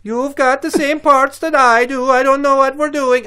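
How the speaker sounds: very high, loud, panicky Dutch squeal; mock Dutch accent. Alien man baffled by matching parts, shrill mock Dutch accent. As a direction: shriek it as a bewildered Dutch alien. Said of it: "they sound like they're Dutch".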